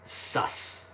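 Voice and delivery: breathy whispered sus. Retold or imitated. Voicing the villagers' suspicion as a whisper.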